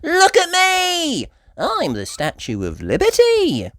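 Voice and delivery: high, loud, slow proud call; playful posing child; Peppa showing off. Acted. Peppa playing.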